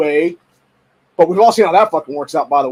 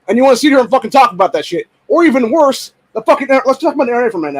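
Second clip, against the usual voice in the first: normal talking voice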